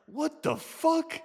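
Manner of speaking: high, slow, drawn-out astonishment; stunned disbelief. Retold or imitated. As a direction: stretch it out high in shock. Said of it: "Everyone's like".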